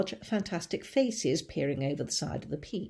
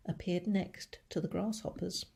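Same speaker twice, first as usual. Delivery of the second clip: usual speaking voice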